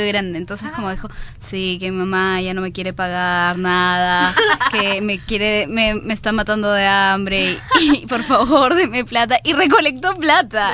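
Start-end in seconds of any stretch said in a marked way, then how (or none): 1.4-9.4 slow, drawn-out fake gringo Spanish; mock plea in a sluggish gringo accent. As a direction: play a gringo begging pitifully for money. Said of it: "como en su acento, tipo de gringo".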